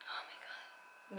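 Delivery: breathy awed whisper, slow. Real, not acted. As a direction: hush it out in awe. Awed whisper on smelling perfume.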